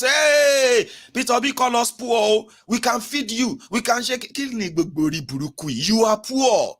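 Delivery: hammed-up indignant outburst; mock outrage. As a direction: ham up the offended crowd. Imitating outraged northerners.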